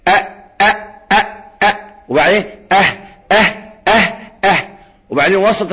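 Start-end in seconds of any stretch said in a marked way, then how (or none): 0.1-1.8 short separate أ stops; hamza demo. Shown as a demonstration. Teacher shows deepest throat letter, hamza demo. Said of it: "أقصى الحلق يخرج منه الهمزة". 2.7-4.7 short أه, each ending in a breath; haa demo. Shown as a demonstration. Teacher shows deepest throat letter, haa demo. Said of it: "الهمزة والهاء".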